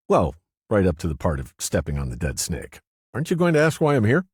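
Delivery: lightly voiced woman, playful; Cynthia, teasing. A narrator's reading. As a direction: tease him, then rush the question.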